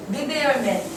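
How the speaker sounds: 리베어맨 with a voiced b sound; wrong pronunciation. Shown as a demonstration. Teacher imitates a common student mistake. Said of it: "리베어맨이 되면 안 되지".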